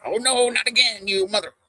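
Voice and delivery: high, hammed-up cursing at a spider; mock fury at a spider; mock cussing out. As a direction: snap at the bug in mock outrage. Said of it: "cuss it out as I kill it".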